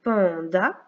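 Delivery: "Panda" in two separated syllables, rising at the end. Shown as a demonstration. Teacher reading exercise words to tick syllables, syllable by syllable. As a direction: stretch "Pan", then lift on "da". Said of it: "on coche la syllabe".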